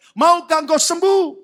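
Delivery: loud, high gentle question; Jesus asking. Acted. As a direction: voice Jesus calling out to the sick man. Jesus voiced in sermon.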